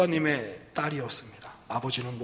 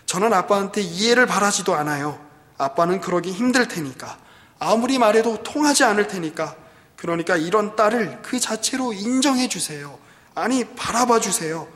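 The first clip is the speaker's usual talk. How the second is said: loud, raised-pitch acted appeal; pleading daughter; 6 semitones higher, 9 dB louder than usual. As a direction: plead with father as his exasperated daughter. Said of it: "딸이 ... 마지막 부분에 이렇게 이야기합니다". (아빠에게 호소하는 딸처럼 높고 크게)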